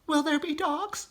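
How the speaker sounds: put-on high little child voice; scared cub asking mother; small frightened fox child. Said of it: "he said".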